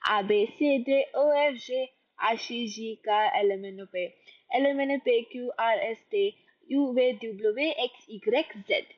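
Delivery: letters "A, B, C" in French, as ah, bay, say, run on quickly; the alphabet from "A" to "Z" in quick French pronunciation; rapid French alphabet. Shown as a demonstration. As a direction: rattle off "A, B, C" to "Z" with French letter names. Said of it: "say it in French first, really fast".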